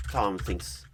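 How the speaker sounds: usual speaking voice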